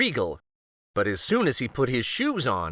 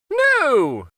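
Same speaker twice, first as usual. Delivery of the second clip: much higher, loud fake-casual no; king's quick denial; 13 semitones higher, 6 dB louder than usual. King to the queen, dismissive high denial. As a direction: brush it off as the king. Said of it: "said King Kenric".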